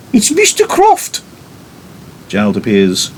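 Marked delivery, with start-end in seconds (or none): 0.1-1.2 high, slow squeaky maid's announcement; announcing maid. Acted. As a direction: pipe it up like a flustered maid.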